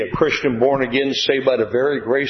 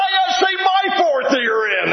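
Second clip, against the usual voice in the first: excited shout. Retold or imitated. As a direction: shout it out in delight. Excited retelling of joyful meeting.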